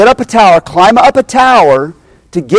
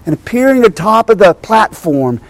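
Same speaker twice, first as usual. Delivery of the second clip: ordinary talk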